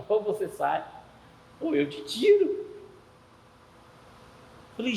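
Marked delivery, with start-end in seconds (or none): 0.1-2.6 half laughing. Retold or imitated. God repeating a warning to someone who missed it, half laughing. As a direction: chuckle into the warning, lifting the last words. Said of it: "ele fala meio que rindo".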